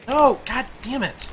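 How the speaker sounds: angry cry of loss. Real, not acted. Weapon lost.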